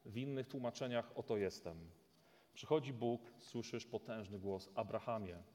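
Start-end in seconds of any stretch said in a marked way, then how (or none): 1.1-5.4 solemn dramatic Bible reading; scripture reading. Acted. Preacher reading Genesis to the congregation, solemn. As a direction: read the story with weight. Reads God's call to Abraham.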